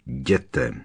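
low, menacing order; výhrůžně. A narrator's reading. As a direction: send them off with a low threat. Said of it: "jeho hlas zněl výhrůžně". (hluboký výhrůžný rozkaz)

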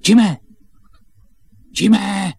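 high, very loud shout; calling out. Acted. Boy calls for his captive friend, shouting. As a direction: shout the name to find him.

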